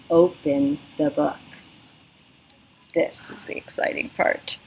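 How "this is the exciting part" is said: hushed, breathy anticipation; excited whisper; whispered excitement. Shown as a demonstration. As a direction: whisper it like a secret treat. Teacher builds suspense before opening.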